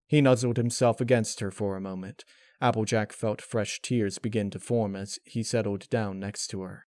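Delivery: ordinary delivery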